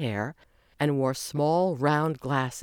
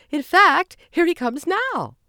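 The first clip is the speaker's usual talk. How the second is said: much higher, loud, slow; excited announcement; 14 semitones higher, 8 dB louder, slower than usual. A narrator's reading. Margie spotting the limo, excited. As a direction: point him out with delight.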